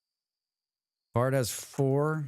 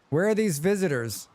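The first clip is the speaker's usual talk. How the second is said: mocking taunt; 6 semitones higher than usual. Taunting absent visitors.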